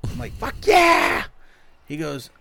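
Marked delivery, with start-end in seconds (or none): 0.3-1.2 high, loud delighted shout; excited shout. Retold or imitated. Friend reenacting his thrilled reply to free chicken, shouting. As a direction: whoop it like a fan.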